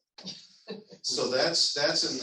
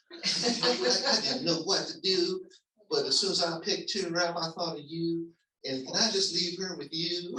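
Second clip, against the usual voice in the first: higher, laughing voice of the friend Peter; imitating friend Peter; 7 semitones higher, 4 dB louder than usual. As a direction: play Peter, giggly and pleading. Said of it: "And Peter said".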